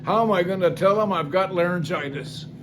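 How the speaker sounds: ordinary delivery, nothing marked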